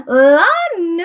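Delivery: gasp, then call the name out high and loud; delighted to see a friend arrive; excited gasp and greeting. Real, not acted. Greeting a viewer joining.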